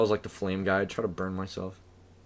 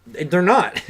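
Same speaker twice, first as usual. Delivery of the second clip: louder, higher insistence; emphatic loud; 8 semitones higher, 8 dB louder, slower than usual. Real, not acted. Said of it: "they're not gonna do it".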